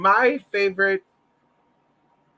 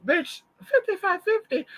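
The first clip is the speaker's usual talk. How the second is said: much higher, stunned mock shriek; shocked disbelief; 14 semitones higher than usual. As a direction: squeak it like the number just slapped you. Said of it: "And I was like, you know, looking".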